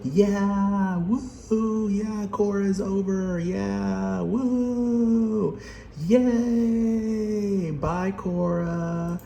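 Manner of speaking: high squeaky gleeful fans, sing-song la la; mocking cheering fans. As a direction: cheer like giddy fans celebrating. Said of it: "everyone in the comments will be like".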